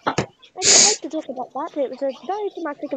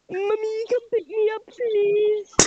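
higher, whiny mock-child plea; 5 semitones higher than usual. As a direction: plead like a little kid, drawing out please. Mock child pleading to mummy.

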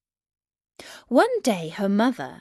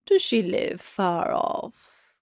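slow, lightly voiced storybook wolf; slower than usual. A narrator's reading. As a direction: draw the question out as the wolf. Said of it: "said the wolf".

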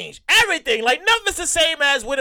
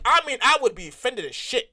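ordinary talk

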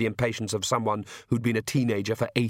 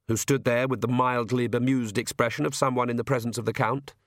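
usual speaking voice

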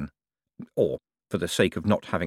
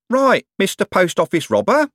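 high, loud, hearty bellow; big cheery shout, pitched high; 11 semitones higher, 5 dB louder than usual. A narrator's reading. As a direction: call out with loud mock cheer. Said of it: "a cheery voice bellowed".

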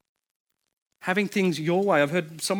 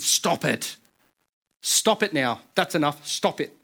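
stern dad; slower than usual. Retold or imitated. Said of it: "my domineering nature".

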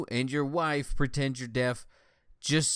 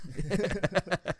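laugh; 8 semitones higher than usual. Real, not acted. Laughing after impression.

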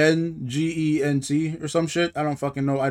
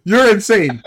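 raised, heated accusation; angry outburst; 5 semitones higher, 4 dB louder than usual. Real, not acted. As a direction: accuse them in a higher, louder voice. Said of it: "You're just angry. You're, you're seething".

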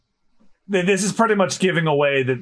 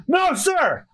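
crew shouting back; 11 semitones higher than usual. Acted. Crew answers louder on demand.

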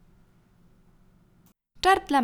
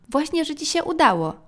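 emphatic contradiction; 6 semitones higher, 4 dB louder than usual. A narrator's reading. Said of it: "powiedziała mama".

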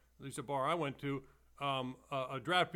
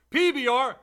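high, loud vendor shout; stadium vendor hawking; 9 semitones higher, 12 dB louder than usual. Shown as a demonstration. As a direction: bark it out to the crowd. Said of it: "that's all you had to say".

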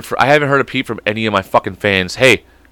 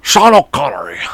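put-on Scottish accent, rolled and slurred; mock Scottish Sean Connery; Sean Connery impression; 5 semitones higher than usual. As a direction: lean into the Scottish burr. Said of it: "Oh, that's not bad, Sean O'Connery".

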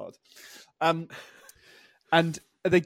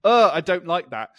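put-on disgusted kid; 3 semitones higher, 12 dB louder than usual. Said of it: "I went".

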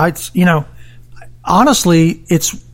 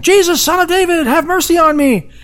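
pleading cry; 11 semitones higher than usual. Retold or imitated. Blind man crying out to Jesus.

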